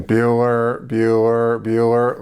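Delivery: flat, droning roll call; monotone teacher. Retold or imitated. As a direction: drone the name like a bored teacher. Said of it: "like, monotone".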